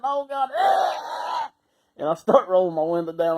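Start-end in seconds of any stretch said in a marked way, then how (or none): 0.0-1.5 high, loud wail of revulsion; disgusted outcry. Retold or imitated. Retelling the whiff in the car, hammed-up revolted shout. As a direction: cry out, overcome by a stink. Retelling his panic at the smell.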